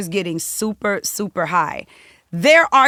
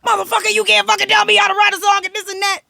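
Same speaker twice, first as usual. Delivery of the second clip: furious shout; 13 semitones higher, 7 dB louder, faster than usual. Retold or imitated. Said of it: "And they're going off".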